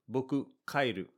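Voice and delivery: blunt, flat announcement of leaving; just state it, no warmth; dry and cold. Shown as a demonstration. Telling a friend you're going home, dry and cold. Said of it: "this sounds a little dry and a little cold".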